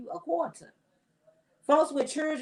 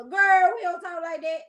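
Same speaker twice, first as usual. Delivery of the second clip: put-on a loud, higher "Girl!" then settling back down; louder, higher scolding that drops off after the first word; exasperated call-out; 8 semitones higher, 7 dB louder than usual. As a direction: snap at her like a fed-up auntie. Scolding an imagined girl directly.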